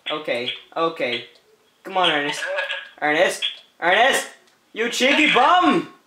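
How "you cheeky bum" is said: playful yell. Real, not acted. Yelling at Ernest who escaped.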